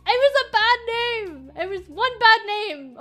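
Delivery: high, loud self-defence; loud protest. Real, not acted. Streamer defending one bad name to chat, loud protest.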